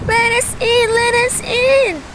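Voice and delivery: high, loud desperate pleading; crying drowning people. A narrator's reading. Drowning people begging Noah at the boat door, high desperate cry. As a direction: beg to be let in, high and loud. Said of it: "they cried".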